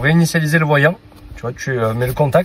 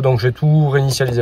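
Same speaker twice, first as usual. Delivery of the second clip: ordinary talk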